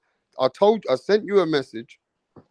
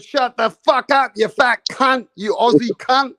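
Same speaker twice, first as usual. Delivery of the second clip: louder, higher, slow ranting mock insult; mock angry yell; 8 semitones higher, 4 dB louder than usual. Imitating a boxing guest cursing at Mirage, mock yell. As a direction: ham up a furious rival's insult. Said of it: "He said".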